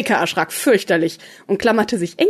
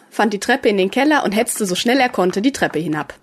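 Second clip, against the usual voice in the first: plain reading voice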